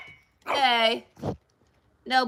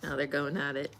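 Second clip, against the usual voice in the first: ordinary delivery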